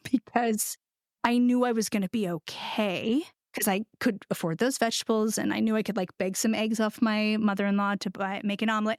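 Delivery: normal talking voice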